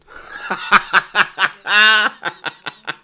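big burst of real laughter; loud laughter. Real, not acted. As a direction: laugh hard at the joke. Laughing at Salah missing chances.

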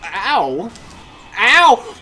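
loud, high indignant outburst; outraged yelp. Real, not acted. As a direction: yelp then rant in disbelief. Hit unfairly by boss.

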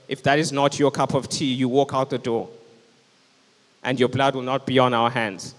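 normal talking voice

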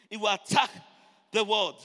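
loud preaching in short bursts; full-force preaching. Real, not acted. Preacher warns of attacks on the word, full-force preaching. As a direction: hit each phrase loud for the congregation.

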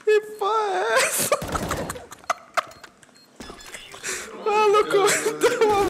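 incredulous high whine. Real, not acted. High whine at unfair play.

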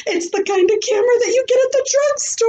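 high and rapid; girl showing her new camera to the class, excited. Acted. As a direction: hold it up and crow.

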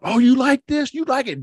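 high, loud mocking taunt; mock angry taunt at the rival; angry jockey. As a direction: sneer it like a furious rider shoving back. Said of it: "you know, he's angry".

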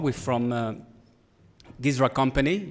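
ordinary talk, nothing marked

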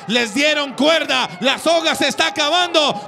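rising shout over the finish; excited shouting commentator. Real, not acted. Live race call, finish near.

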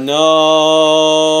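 long, loud, held no; wailed cry of defeat. Real, not acted. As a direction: stretch it out in a sustained wail. Losing moment in the game.

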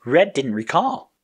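ordinary delivery

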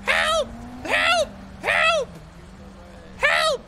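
screaming for help. Acted. Passenger calling for help during a fare dispute, screaming. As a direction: scream out for help in short bursts. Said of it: "She's just screaming, "Help!".

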